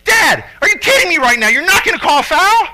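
loud, high, indignant shout at dad; frustrated kid shouting. Retold or imitated. Son yelling at his dad-coach for no foul call, indignant shout. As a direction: shout it like a kid who can't believe it. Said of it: "just, like, beside himself".

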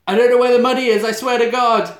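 high, loud frantic pleading; panicked mum. Acted. Mum begging Jigsaw over the money, panicked plea. Acting terrified mum under threat.

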